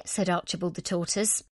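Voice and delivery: plain reading voice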